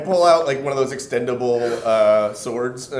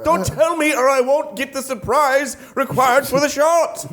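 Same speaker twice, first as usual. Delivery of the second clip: high bossy boss; 13 semitones higher than usual. Acted. Character bossing the cameraman.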